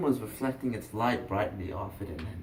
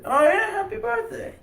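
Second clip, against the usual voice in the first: very high, loud burst of delight; sudden whooping good wishes; cheery congratulation; 9 dB louder than usual. Acted. Fisherman congratulating boy on twelfth birthday, delighted.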